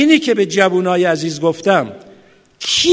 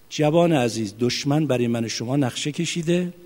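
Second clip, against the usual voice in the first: quiet earnest warning; 2 semitones lower, 9 dB quieter than usual. Real, not acted.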